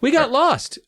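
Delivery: put-on high young girl voice; lost girl explaining. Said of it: "Audra told him".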